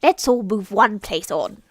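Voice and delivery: loud, commanding mad host; bossy Hatter. Acted. Hatter ordering the guests around, loud and commanding. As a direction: order the table loudly.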